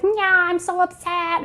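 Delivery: lightly hammed-up monster complaining. The defeated monster whines, a high mock monster voice.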